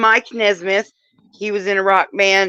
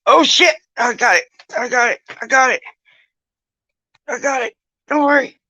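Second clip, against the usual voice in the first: hurried alarm; 6 semitones higher, 5 dB louder than usual. Real, not acted. Rushing to catch something.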